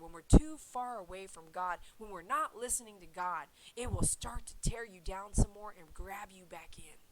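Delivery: ordinary talk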